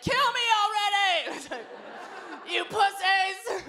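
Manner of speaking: high, slow taunting yell; a grandma taunting the hurricane; defiant yell. Retold or imitated. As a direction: dare the storm, drawn out. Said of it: "She's like".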